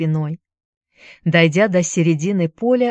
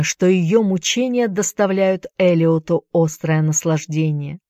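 usual speaking voice

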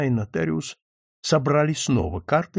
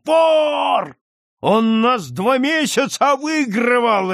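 high, loud accusing bellow; stretched, full-voiced angry shouting; furious roar; 9 semitones higher, 5 dB louder, slower than usual. A narrator's reading. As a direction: roar it out in outrage. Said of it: "ревел Свищев".

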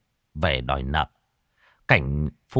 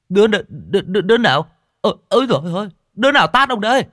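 high, loud stammered demand; indignant yelp, tripping over the first word; outraged victim; 12 semitones higher, 6 dB louder, much faster than usual. Acted. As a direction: splutter in shock after being slapped. Slapped man demands who hit him.

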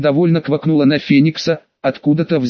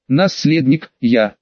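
ordinary delivery, nothing marked

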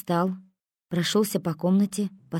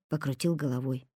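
ordinary delivery, nothing marked

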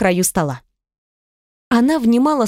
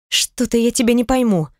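ordinary delivery

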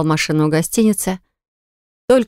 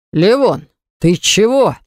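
puffing; 2 semitones higher, slower than usual. Acted. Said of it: "пропыхтел Баграт".

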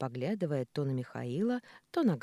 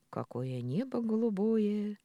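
slow, higher dreamy musing; pensive; 7 semitones higher, slower than usual. A narrator's reading. Injured neighbour lying on the grass gazing at the sky, pensive. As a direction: draw it out, lost in thought. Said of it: "произнёс он задумчиво".

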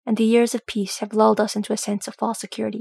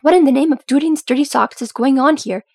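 loud, raised, outraged demand; appalled screech; 4 semitones higher, 7 dB louder than usual. A narrator's reading. Dory finds the beam falling, screeching appalled. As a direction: screech it at the whole crowd. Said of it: "came Dory's appalled screech".

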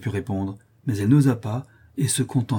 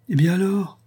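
high, ringing exclaimed question; triumphant; 10 semitones higher, 4 dB louder than usual. A narrator's reading. Madame Guy seizing on the explanation, triumphant. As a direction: pounce on it triumphantly, pitched way up. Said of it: "d'une voix éclatante et d'un ton triomphant".

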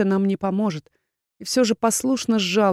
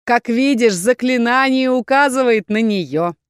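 higher, slow, self-satisfied villain; pleased gloating; 5 semitones higher, 5 dB louder than usual. A narrator's reading. Rebel leader showing Erkhan the spell, pleased. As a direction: present the proof with relish. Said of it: "довольно сообщил лидер".

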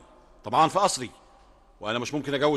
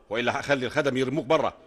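ordinary talk

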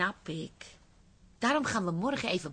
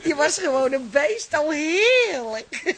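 louder, higher swooning delight; delighted gush; 7 semitones higher, 11 dB louder, slower than usual. Acted. Wife reliving the wild lift night, loud swooning delight. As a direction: swoon over the memory out loud.

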